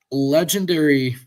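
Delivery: ordinary talk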